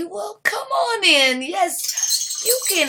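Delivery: high, slow, sugary welcome; fake sweet welcome. Owner luring the snooty family inside, high and sugary. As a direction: lure them in with false charm.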